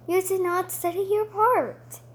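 sternly scolding, high and loud; cross teacher. Acted. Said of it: "Miss Moon was very cross".

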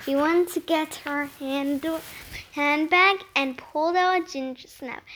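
plain reading voice

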